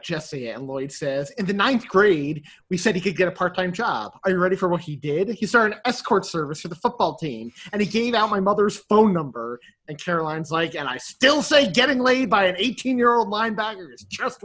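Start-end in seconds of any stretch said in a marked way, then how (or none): 1.4-9.6 rising temper. Retold or imitated. Lloyd's temper rising, voiced. 10.7-14.4 sharp retort. Retold or imitated. Caroline retorting, voiced.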